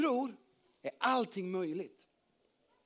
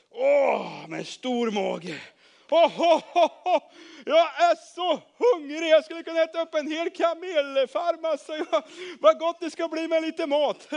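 loud, hammed-up glutton with ho-ho laugh; hungry big-bellied man; 8 semitones higher, 9 dB louder than usual. A narrator's reading. Big-bellied man longing for food, loud and hammed-up. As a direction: boom it out high and hungry.

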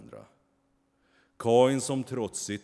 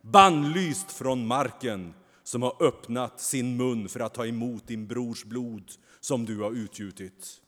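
furious curse; 3 semitones higher, 8 dB louder than usual. A narrator's reading. The angel banishes Kain in a raging curse. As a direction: pronounce the banishment in fury. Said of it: "i en rasande besvärjelse".

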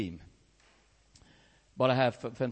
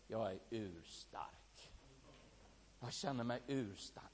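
softly proud; 5 semitones lower, 14 dB quieter, slower than usual. Retold or imitated. Girl quoted, blinking.

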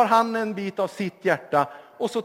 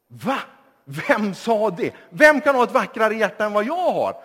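indignant disbelief; 3 semitones higher, 6 dB louder than usual. Acted. Young man challenged, indignant.

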